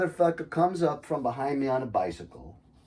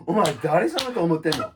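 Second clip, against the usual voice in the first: aggressive Kansai Japanese, higher and louder, laughing through it; Kansai Ben blast; 7 semitones higher, 7 dB louder than usual. Retold or imitated. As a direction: fire off rough Kansai dialect like a shotgun blast. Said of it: "shotgun blast of, like, Kansai Ben".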